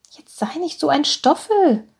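high-pitched mock scolding of a grown son; chiding mother. A narrator's reading. Senior doctor's mother scolds her son, high and chiding. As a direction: chide him in a high motherly voice.

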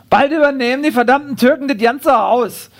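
high-pitched Berlin-dialect grumbling; acted indignant busybody rant; bigoted neighbour.

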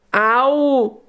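long, high cry of ow; cry of pain. A narrator's reading. Hare stuck in the narrow gap, high acted cry of pain. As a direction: yelp it high and drawn out. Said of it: "ruft Leo".

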